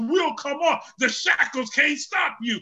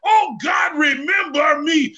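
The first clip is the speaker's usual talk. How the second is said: loud, high plea; crying out to God; 4 semitones higher, 5 dB louder, slower than usual. Acted. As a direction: cry it out as a desperate prayer. Samson's prayer voiced in sermon.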